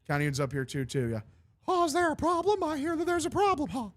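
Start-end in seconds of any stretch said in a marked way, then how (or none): 1.7-3.9 high cartoon mouse, menacingly cheery; Mickey Mouse. Mickey confronts the [name], high mouse voice. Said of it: "South Park scene with Mickey Mouse".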